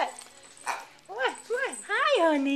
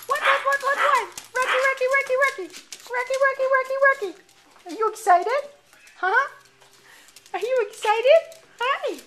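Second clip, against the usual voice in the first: excited dog talk; 7 semitones higher, 12 dB louder, faster than usual. Real, not acted.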